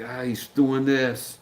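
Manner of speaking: slow, lightly voiced fretting wife on the phone; a hint of a worn-out wife reporting, drawn out; worried wife. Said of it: "phone calls from his wife like".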